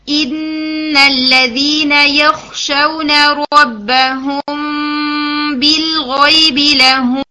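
very slow, melodic tajweed recital; sung Quran recitation. Shown as a demonstration. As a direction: chant the verse slowly, holding each ghunnah. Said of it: "میں ان کو اکٹھا پڑھتی ہوں".

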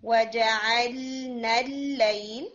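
slow وَجَعَلْنَا, each letter held; unhurried letters. Shown as a demonstration. Teacher modelling slow, even letters for the student. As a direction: take time on every letter of وَجَعَلْنَا. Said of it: "ہر letter کو time دیجیے".

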